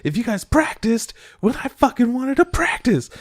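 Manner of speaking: high, squeaky mock rant at her bandmates; shrill angry girl voice. Girl yelling at her bandmates, in a high mock rant. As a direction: pitch way up and whine it out. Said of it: "She's like".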